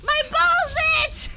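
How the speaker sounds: high, loud, drawn-out squeal through laughter; laughing shriek. Real, not acted. Streamer reading a funny Twitch name, laughing shriek. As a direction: shriek it out, cracking up.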